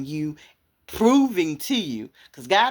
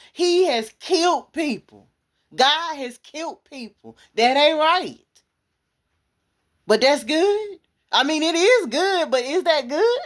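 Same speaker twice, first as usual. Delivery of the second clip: high, loud, urgent preaching with a laugh; preacher at full force arguing about God's justice; fiery preaching; 10 semitones higher, 4 dB louder than usual. Real, not acted.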